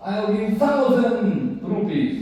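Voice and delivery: high, slow, indignant outbidding; acted affront, pitched well up and drawn out; challenged businessman. Rich businessman outbids the pujari for the garland, high-pitched indignant challenge. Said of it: "businessman ac-, accepted challenge".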